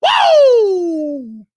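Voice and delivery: a high, loud, held woo; high loud whoop. Real, not acted. Streamer cheering his live audience with a loud high whoop. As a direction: let out a big hype whoop. Hype intro shout.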